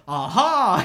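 loud, drawn-out triumphant "aha"; gotcha exclamation. Retold or imitated. Cop pretending he found drugs, mock triumphant. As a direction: crow it like catching someone out.

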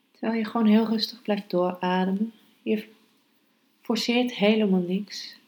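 calm, hushed meditation guidance. Acted. Leads a meditation, breath focus. (zachte, rustige meditatiestem)

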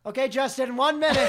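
high, loud time warning; loud call. Real, not acted. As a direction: call out the minute left. Announcing time left, teasing.

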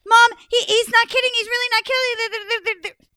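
high, loud frightened insisting; scared shout. Acted. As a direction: beg mom to believe you. Frightened sister after ghost.

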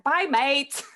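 put-on loud, chirpy British mate accent. Friend says goodbye at the airport in a cheery British accent. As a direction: toss it off like a cheery London girl. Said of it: "So British".

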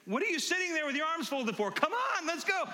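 high, mock-impatient urging; playful goading. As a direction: goof around, egging the crowd on. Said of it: "I'm just kidding. I'm just, like, just goofing around".

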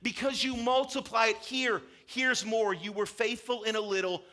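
excited master giving praise; delighted master. Retold or imitated. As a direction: burst with delight at the servant. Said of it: "the master's blown away".

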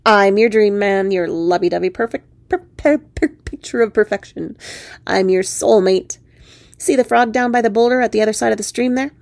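puffed-up frog character voice; boastful talking frog. Acted. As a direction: proclaim it as a self-admiring frog prince.

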